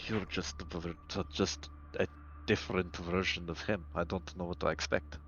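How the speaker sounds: very quiet, low muttering; under his breath. Acted. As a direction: mutter it privately, low and soft. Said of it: "you hear him say under his breath".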